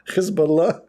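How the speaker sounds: Hezbollah with a throaty kh, rising; mock Hebrew kh. Shown as a demonstration. Mocking the Israeli spokesman, throaty kh. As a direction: rasp the h in Hezbollah. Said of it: "Israeli pronunciation".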